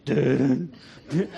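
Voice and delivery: low two-note shark motif by mouth; Jaws theme imitation. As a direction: voice the lurking shark's music, low. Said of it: "all of a sudden you hear this".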